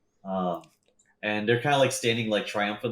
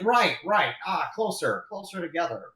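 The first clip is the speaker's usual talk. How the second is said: high, swooping directions; mock yelling; 7 semitones higher than usual. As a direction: pretend yell, pitched up without full volume. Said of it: "sometimes he yells in Chusein".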